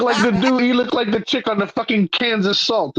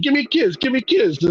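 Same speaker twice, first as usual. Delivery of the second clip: high mock Italian old-timer; hammed-up old Italian man beckoning; 7 semitones higher than usual. Old neighbor beckoning the teen, high mock Italian. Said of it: "he's telling me".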